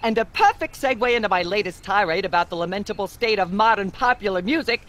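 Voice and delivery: ordinary delivery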